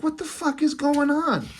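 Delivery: high-pitched mock panic; freaked-out woman. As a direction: voice jumps way up, imitating a bewildered woman. Handcuffed, freaked-out woman in comic.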